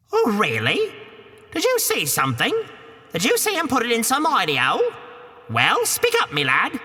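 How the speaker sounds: put-on high Cockney woman shooing the boy; a high, brash Cockney housewife; Cockney ogre's wife. Wife shoos Jack from the castle door, high Cockney.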